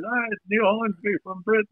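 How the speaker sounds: high-pitched mock British accent; bad British accent. Retelling the caller's phone introduction, badly imitated British accent. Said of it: "I can't do a British accent".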